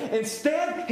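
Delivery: usual speaking voice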